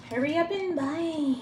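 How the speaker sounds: lilting slow mock-ad pitch; teasing sales pitch. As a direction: stretch it out like a cheeky jingle. Repeats sales plug, drawn out.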